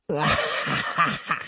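short mocking crone laugh; old woman's cackle. Old woman laughing at her enemy, quavering cackle. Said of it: "Her wrinkled neck quivered as she laughed".

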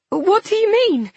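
high, urgent bewildered question; alarmed confusion. A narrator's reading. Woman flooded with adrenaline, alarmed confusion. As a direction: demand an explanation, rattled.

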